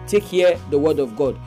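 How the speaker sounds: ordinary talk, nothing marked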